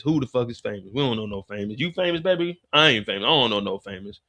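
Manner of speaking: low, fast brush-off; low rapid-fire stonewalling; dismissive denial. Retold or imitated. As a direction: wave it off, low and quick. Pops stonewalls Jukebox at gunpoint.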